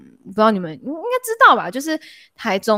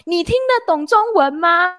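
exaggerated loud question; 8 semitones higher than usual. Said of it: "故意放大声说".

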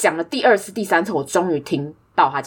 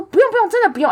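insistent refusal; 13 semitones higher, faster than usual. Retold or imitated. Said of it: "所以我就说".

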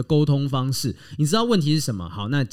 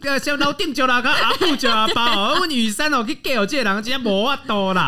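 medicine radio host; 9 semitones higher than usual. Pretend. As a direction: sell miracle tonic on local radio. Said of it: "卖药电台。".

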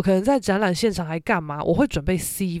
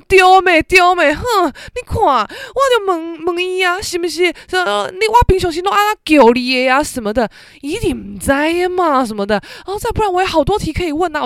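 much higher, much louder boasting mother, calling 丢妹 loudly and slowing on 我就问; smug proud mom; 10 semitones higher, 6 dB louder than usual. Retold or imitated. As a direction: play mom crowing over her clever trick. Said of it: "我妈就很得意".

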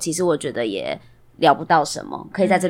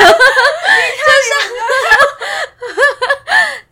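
loud shrieking laugh; 9 dB louder than usual. Real, not acted. Reaction to quoted rude reply.

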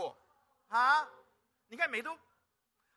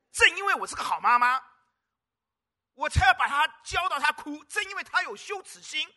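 loud, high, emphatic declaration; forceful preaching; 8 semitones higher, 11 dB louder than usual. Acted. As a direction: proclaim it with full conviction. Preacher speaking as the good mother.

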